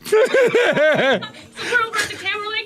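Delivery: high, loud words through real laughter; can barely get it out for laughing; laughing hard. Real, not acted. Laughing while telling Tyler story.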